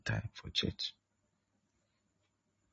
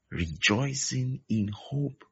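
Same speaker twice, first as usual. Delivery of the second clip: rejoicing in hope drawn out slowly and lifted; slow scripture reading; 3 semitones higher, slower than usual. Shown as a demonstration. Preacher reciting Romans twelve to the congregation, slow and lifted. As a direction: read it slow and high, savouring each word. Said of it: "Patient in tribulation".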